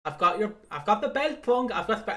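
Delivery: a hint of a cocky wrestler holding up the belt; mock taunt at Punk; Drew McIntyre taunt. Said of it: "Drew McIntyre goes".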